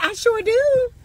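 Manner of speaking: high, sing-song I sure do; a high, sugary yes; delighted lady. Pretend. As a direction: accept the help with girlish delight. Acting woman accepting help.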